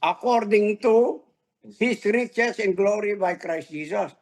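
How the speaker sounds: slow, weighty reading of the verse; scripture reading. Acted. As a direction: read the promise out with weight. (mabagal at mabigat na pagbasa ng talata)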